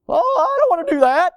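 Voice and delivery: high, loud whining voice, mocking; mock the whiny believer who avoids suffering; whiny complainer. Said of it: "we don't wanna go through those things".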